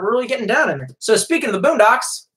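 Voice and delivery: usual speaking voice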